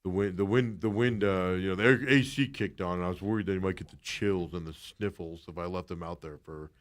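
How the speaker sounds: mocking Torey. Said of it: "I'm surprised Torey didn't go".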